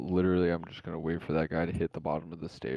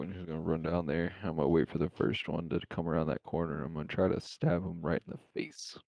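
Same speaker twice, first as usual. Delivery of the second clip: ordinary talk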